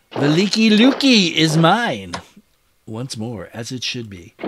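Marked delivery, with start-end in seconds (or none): 0.1-2.1 loud, high victory call; triumphant gloating. Real, not acted. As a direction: proclaim the conquest with relish. Player claims a captured city.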